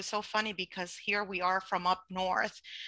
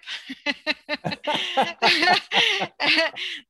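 loud laugh; 9 semitones higher, 7 dB louder than usual. Real, not acted. Laughs at round trip question.

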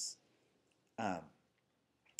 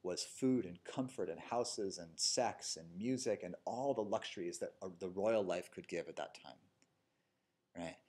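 normal talking voice

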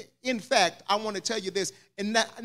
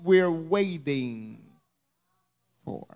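hushed sermon question; 6 semitones lower, 5 dB quieter than usual. Acted. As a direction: drop it down and let it hang. Sudden drop to slow question.

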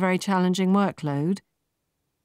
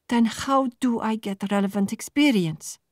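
higher, frustrated demand; plain angry; 7 semitones higher than usual. A narrator's reading. Marta confronting the recruiters, openly angry. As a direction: push back hard at the interviewer. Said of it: "plain angry now".

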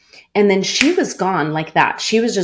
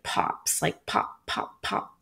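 imitated pops; 9 dB quieter, slower than usual. Imitating strings popping.

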